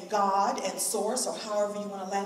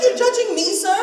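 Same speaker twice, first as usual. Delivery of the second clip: much higher, louder, offended question; indignant disbelief; 10 semitones higher, 11 dB louder than usual. Retold or imitated. She answers the rude security guard, high and outraged. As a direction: ask it like you can't believe it.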